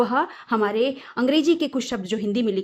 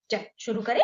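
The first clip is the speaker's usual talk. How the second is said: whispered, playful start. Acted. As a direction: breathe it as a secret. (फुसफुसाकर शुरुआत)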